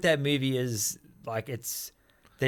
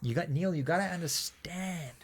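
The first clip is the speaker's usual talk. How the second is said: slow, softer nasal American voice; talking through nose; 6 dB quieter, slower than usual. Shown as a demonstration. Teaching the co-host to sound American, nasal. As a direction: drawl it slowly through the nose. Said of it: "talk through your nose like this".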